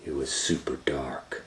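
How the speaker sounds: ordinary delivery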